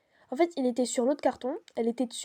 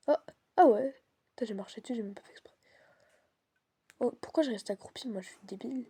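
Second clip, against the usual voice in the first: nervous whisper; scared horror game streamer. Real, not acted. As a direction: whisper it, afraid of being heard.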